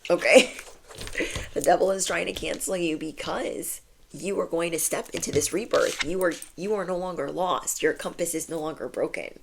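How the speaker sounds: ordinary delivery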